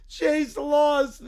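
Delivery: put-on whiny angry little boy, shouting in bursts; furious child voice yelling at the town; Caillou tantrum. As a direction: throw a bratty tantrum as a spoiled kid. Caillou voice continues.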